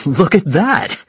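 high-pitched exclamation of praise; proud father's excited exclamation; delighted cry. Acted. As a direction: cry out, impressed by your child. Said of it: "he cried".

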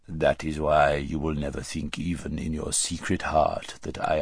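low, soft ruler's voice; cold, lordly Tisroc. Acted. Tisroc reading his vizier's mind, coldly lordly. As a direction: speak low and measured, as absolute ruler.

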